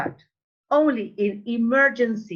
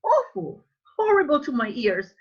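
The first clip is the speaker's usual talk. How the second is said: high-pitched, appalled cry; exaggerated dismay; 6 semitones higher, 3 dB louder than usual. Real, not acted. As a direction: recoil at how bad it sounds. Teacher condemns the bad pronunciation.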